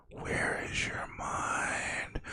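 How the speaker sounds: slow, breathy whisper. Retold or imitated. Whispering at a driver lost in thought. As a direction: hush it like a puzzled secret. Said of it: "I'm like".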